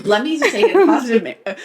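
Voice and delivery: laughing as you try to get the words out; laughing protest. Real, not acted. Defending the odd compliment to teasing friends, laughing protest. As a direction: plead through real laughter. Pleading through laughter at a tease.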